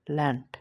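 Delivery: "ল্যান্ড" ending in a t sound; final d as t. Shown as a demonstration. Teacher showing d at word end, "ল্যান্ড" closed with t. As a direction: say "ল্যান্ড" with its last d turned to t. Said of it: "ডেটা টর মতন উচ্চারণ হবে".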